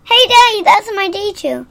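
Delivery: high, loud excited call; excited shout. Real, not acted. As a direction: call out to Daddy, thrilled. Kid calls out excitedly.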